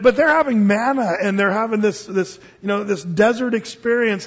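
ordinary talk

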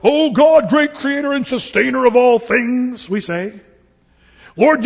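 loud, high, pompous preacher's bellow; mocking televangelist prayer. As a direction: ham up an arrogant televangelist demanding from God. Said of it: "We pastors, we can do that".

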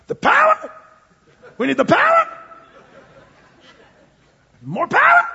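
very high, loud televangelist cry; TV preacher. Acted. Preacher mimicking TV preachers calling for power, hammed-up shout. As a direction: whoop it like a hammed-up TV evangelist. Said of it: "that's the way they say it on T V".

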